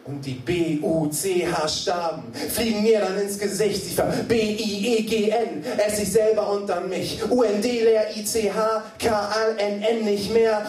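disintegrating spoken-word climax; slam poetry spelling. Acted. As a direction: spell it out with rising desperation. Spells out words in poem.

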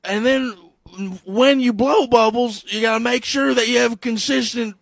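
hammed-up presidential address; Joe Biden. Acting Biden explaining bubble blowing.

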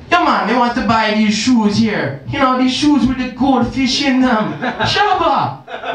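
a mock Jamaican patter; lightly put-on Jamaican accent. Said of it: "with a Jamaican accent".

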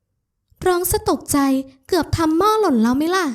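high, slow, drawn-out grumble; cross scolding. A narrator's reading. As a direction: scold him in the woman's high voice. Scolding him for startling her. (บ่นดุเสียงสูง ลากเสียง)

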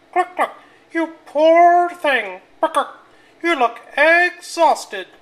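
very high, loud, slow clucking hen voice; a fussing mother hen. Acted. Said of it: "clucked the hens".

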